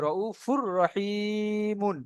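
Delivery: "রউফুর রহিমুন" with doubled r and long i; full merging. Shown as a demonstration. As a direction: recite "রউফুর রহিমুন" slowly, no n before the r. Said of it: "কিভাবে উচ্চারণটি হচ্ছে".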